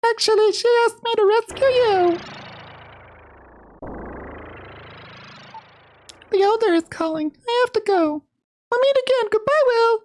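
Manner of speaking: put-on very high falsetto girl voice. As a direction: squeak it out like a tiny fairy girl.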